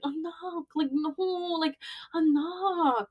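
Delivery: high, pleading Filipino mom voice; imitated Filipino mom. Filipino mom urging daughter to rest, imitated coaxing. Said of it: "my mom's Filipino, she's like".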